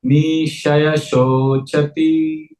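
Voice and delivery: very slow, drawn-out Sanskrit verse; slow recitation. Shown as a demonstration. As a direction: stretch each syllable for repeating after you. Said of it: "say it slowly".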